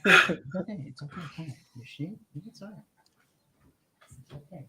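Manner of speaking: very soft, high murmur to a pet; soothing the dog. Real, not acted. Owner hushing dog after aftershock, very soft murmur. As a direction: hush the dog gently.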